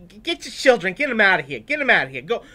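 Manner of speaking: high, loud shooing; hammed-up bossy disciples; scolding disciples. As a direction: chase the kids off, exasperated. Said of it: "that's how they sounded back then".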